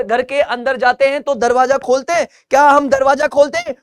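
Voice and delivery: ordinary talk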